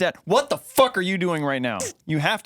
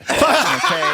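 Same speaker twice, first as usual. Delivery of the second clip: indignant yelp; 12 semitones higher, 6 dB louder than usual. Real, not acted. Defending his pomade.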